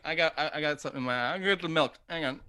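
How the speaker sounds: normal talking voice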